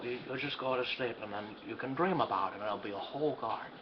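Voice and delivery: soft, lulling bedtime voice; soothing. Acted. Father softly coaxes sick daughter to sleep. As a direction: gently coax her to sleep.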